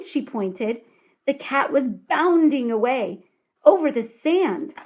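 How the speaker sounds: ordinary delivery, nothing marked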